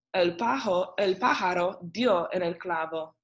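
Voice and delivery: ordinary delivery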